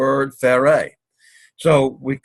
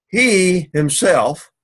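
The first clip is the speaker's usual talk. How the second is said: slow, louder He himself; both words drawn out and punched higher; stress on He himself; 5 semitones higher, 4 dB louder, much slower than usual. Shown as a demonstration. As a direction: lean hard on He himself. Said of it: "emphasizing the ideal of self".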